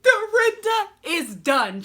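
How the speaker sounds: delighted outcry; shrieking excitement. Real, not acted. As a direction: shriek it in gleeful disbelief. Shrieking reaction to the fight.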